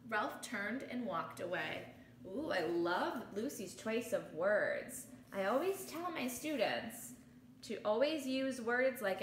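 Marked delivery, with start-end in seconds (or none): none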